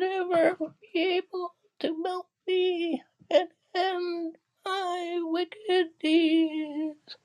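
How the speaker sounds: wailing, despairing witch. Acted. The dying witch bids Dorothy farewell while melting, wailing. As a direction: draw out each phrase in a falsetto wail. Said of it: "in a wailing, despairing voice".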